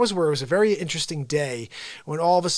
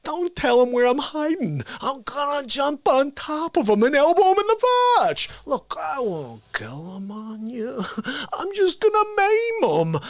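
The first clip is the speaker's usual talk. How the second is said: conspiratorial start swelling into a high, strained cackle-voice; hammed-up villain, swinging from hushed to high; scheming villain; 8 semitones higher, slower than usual. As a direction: gloat like a cartoon villain plotting an ambush. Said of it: "He's like".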